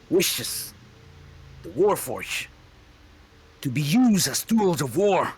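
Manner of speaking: high, loud raging accusation; furious shout. Acted. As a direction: scream at the traitor. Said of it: "Warforged rants at traitor".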